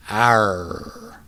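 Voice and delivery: "Arr" held loud with a rolled R. Shown as a demonstration. Brother showing sister the blended A sound. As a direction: roll out "Arr" as one long vowel. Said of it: "those two separate A sounds rolled together".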